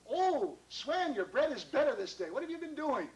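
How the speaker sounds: high, loud, swooping delight; gushing praise. Acted. Missus Irons praises Swen's bread, gushing. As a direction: gush with admiring surprise.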